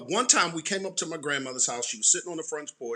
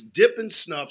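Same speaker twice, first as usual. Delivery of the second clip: ordinary talk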